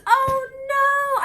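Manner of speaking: high, wailing oh no; real dismay at a broken gift; dismayed cry. Real, not acted. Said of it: "I'm so sad now".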